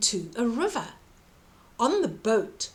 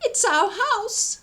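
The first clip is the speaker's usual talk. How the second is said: high, louder squeaking mouse voice; squeaky mouse; 11 semitones higher, 5 dB louder than usual. A narrator's reading. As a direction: squeak it like a worried mother mouse. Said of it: "squeaked the mother mouse".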